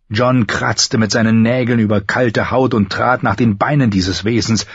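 plain reading voice

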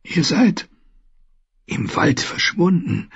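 a hint of a weak old man, surprised; faint wondering voice, lightly done; lightly voiced old maester. Dying maester asks the boys, lightly voiced.

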